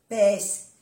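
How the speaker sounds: usual speaking voice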